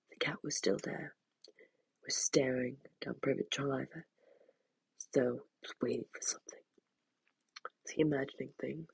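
hushed suspenseful narration; whisper. A narrator's reading. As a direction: whisper it, uneasy. Tense narration drops to whisper.

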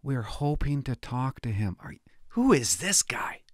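hushed, sneering quote; mock the chief's statement under the breath; mocking whisper. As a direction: whisper it as a ridiculous line.

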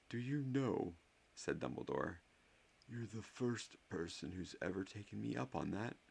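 put-on teasing, twinkly old wizard; Dumbledore's voice. A narrator's reading. Dumbledore amused at Harry.

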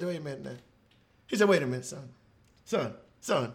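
soft, low, soothing; Jesus calming a weeping father, soft and low; gentle Jesus. Acted. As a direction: hush him tenderly like a comforter. Jesus calms the grieving father.

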